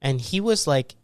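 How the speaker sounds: ordinary talk, nothing marked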